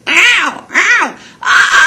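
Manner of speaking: high, loud yelping ow; high pained cry. Shown as a demonstration. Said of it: "You can make that noise".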